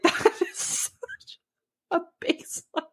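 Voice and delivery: high, slow, laughing. Real, not acted. Mocking the husband's bare minimum, laughing high. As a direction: laugh through the words.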